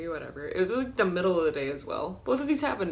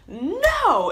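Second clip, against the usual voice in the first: sharp, much higher, loud; yell; 11 semitones higher, 8 dB louder than usual. Retold or imitated. To the robber, loud high yelled refusal. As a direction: bark it at him. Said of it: "And I just yelled".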